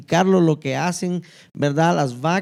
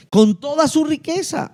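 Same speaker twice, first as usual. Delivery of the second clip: excited exclamation; 8 semitones higher than usual. Acted. Exclaims verse joyfully.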